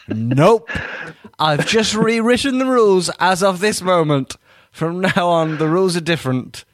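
loud, high mock proclamation; stubborn referee. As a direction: overrule everyone as a cocksure referee. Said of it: "they never, ever change their minds".

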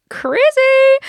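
high, loud drawn-out cheer; mock party whoop. Host joking about being out partying in L A, mock whoop.

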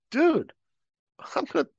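high, disbelieving refusal; incredulous exasperation. Retold or imitated. Narrator telling the guy he must report it, exasperated.

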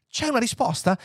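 high, expectant question; bated breath. A narrator's reading. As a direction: ask as if hanging on the answer. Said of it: "chiese Fuchs col fiato sospeso". (domanda acuta, col fiato sospeso)

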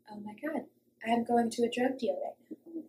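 hushed voice, thinking to oneself; dawning dread. Retold or imitated. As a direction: say it very softly, a private realization. Inner thought, realizing the danger.

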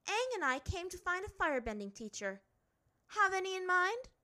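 put-on high, loud, forthright girl's request; brisk, demanding princess. A narrator's reading. Azula asking for a firebending teacher, demanding.

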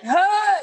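sudden high-pitched gasp; mock shocked gasp. Mother acting out shock at daughter's news, high gasp. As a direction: gasp as the blood drains away.

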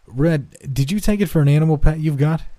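a father catching on and turning to question his son; lifted, pointed parental questioning; lightly voiced father. A narrator's reading.

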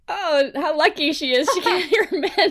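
mock envy, lifted and laughing. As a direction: tease it, pretend to be jealous. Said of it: "we mock".

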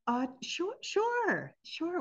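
high, startled sure sure; surprised. Retold or imitated. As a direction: caught off guard, agreeing. Startled, hesitant agreement.

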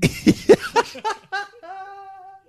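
loud squealing laugh; high-pitched laughter. Real, not acted. Laughing at her face.

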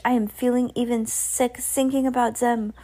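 sickened witch giving orders; Grand High Witch. A narrator's reading. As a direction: complain high, as a disgusted witch. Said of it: "said the Grand High Witch".

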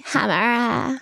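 put-on "Hammer" in a Valley Girl accent; Valley Girl drawl on "Hammer". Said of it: "a Valley Girl accent".

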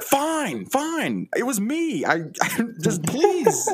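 a worn-out suspect finally caving and begging; cracking suspect. Acted. Suspect cracks after eight hours.

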